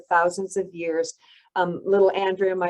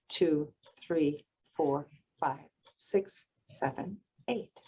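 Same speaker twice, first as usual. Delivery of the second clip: guided breathing count. Shown as a demonstration. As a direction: count them through the breath gently. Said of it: "I'll just be the counter".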